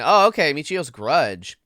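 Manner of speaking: normal talking voice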